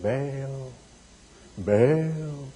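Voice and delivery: very soft, low, hushed "Baal"; mock spooky whisper. Preacher mimicking prophets' hushed chant to Baal. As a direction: breathe "Baal" like a spooky incantation. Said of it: "You gotta say".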